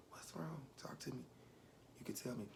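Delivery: very soft, coaxing whisper; weak whisper. Retold or imitated. Dying grandmother urging grandchild to talk, whispering. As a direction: whisper gently, inviting a confession. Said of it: "she was whispering".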